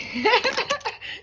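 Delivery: high-pitched squeaky laugh; high giggle. Real, not acted. Cars tumble again, she gives a high giggle.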